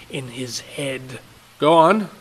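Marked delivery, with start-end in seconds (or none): 1.6-2.1 louder, higher push; urging father. Acted. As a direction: press the boy to eat.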